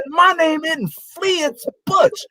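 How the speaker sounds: high, slow mock-indignant retort; retold indignant protest. Player correcting coach on his nickname, mock indignation.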